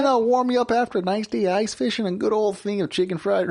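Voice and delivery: high, bright-voiced hammed-up Wisconsin waiter; mock Wisconsin server pitching food; Wisconsin accent. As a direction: sell the burrito in a thick Wisconsin accent. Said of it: "Wisconsin accent".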